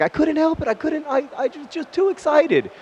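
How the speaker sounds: high, breathless giggly pleading; imitating her high excited voice; giddy excuse. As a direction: squeal it like you couldn't resist. Partner excusing herself, retold.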